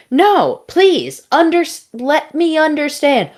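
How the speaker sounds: high, loud mock pleading; hammed-up panicked protest; frantic pleading. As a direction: beg in comic desperation. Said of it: "she sort of goes back and forth with this voice".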